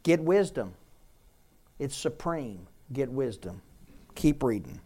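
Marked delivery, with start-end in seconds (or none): none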